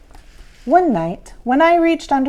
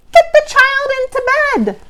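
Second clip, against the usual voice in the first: much higher, squeaky gurgle; gurgling little monster; 6 dB louder than usual. A narrator's reading. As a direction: pipe it up eagerly like a small monster. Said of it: "teetered on his tippy toes and gurgled".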